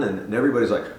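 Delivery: usual speaking voice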